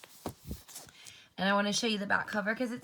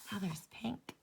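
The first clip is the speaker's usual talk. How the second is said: whisper; 8 dB quieter than usual. Real, not acted. Reading aloud aside.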